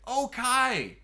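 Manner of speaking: Okai called out high and loud as oh-KYE; pronounced Okai. Shown as a demonstration. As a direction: exclaim Okai, ending like "sky". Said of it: "my name is pronounced".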